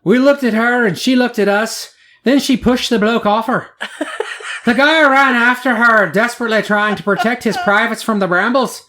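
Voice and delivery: amateur Scottish brogue for a news quote; Scottish accent. Retold or imitated. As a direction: read the quote in a mock Scottish voice. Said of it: "that was Scottish, not English".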